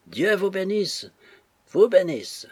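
high, drawn-out parting call; calling out farewell. A narrator's reading. Drunk Joe blesses the departing ladies, calling out. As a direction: call a last blessing after them, pitched high. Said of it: "leur cria un dernier".

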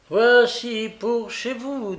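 high, loud, drawn-out voice; mail-coach courier character; courier calling out. A narrator's reading. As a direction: hand it over with a slow call. Courier calls out in story.

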